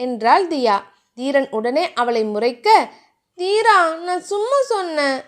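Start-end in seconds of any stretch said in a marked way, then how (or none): 3.4-5.2 a light sad, pleading tone, voiced higher as Dhiya; sadly. A narrator's reading. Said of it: "என்று தியா வருத்தமாக சொல்லவும்". (லேசான வருத்தத்துடன்)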